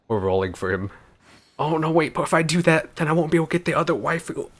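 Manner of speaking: ordinary delivery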